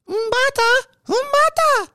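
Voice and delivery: very high falsetto calling the name; hammed-up movie character call. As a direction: call it out in a squeaky falsetto impression. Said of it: "Wilt Chamberlain character".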